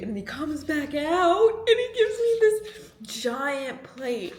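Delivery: slow, high, loud build-up; building excitement. Real, not acted. Telling viewers of the fan's giant plate, slow excited build. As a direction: stretch it out, rising with amazement. Excited climax of gift story.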